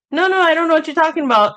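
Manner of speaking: high, loud, rushed mock denial; hammed-up hurried deflection; uncomfortable denial. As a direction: brush them off fast to escape. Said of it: "It could be so uncomfortable".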